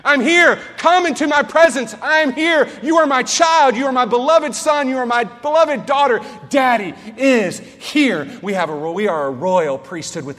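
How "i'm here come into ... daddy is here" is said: much higher and much louder, ringing out; fervent proclamation. Acted. Preacher voicing God to the flock, booming joyful shout. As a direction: proclaim God's answer at full force. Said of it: "He says".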